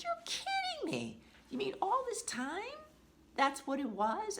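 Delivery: high incredulous retold reaction; floored. Owner retelling her amazed reply to her parrot, incredulous. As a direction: squeak it out in disbelief. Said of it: "And that just floored me".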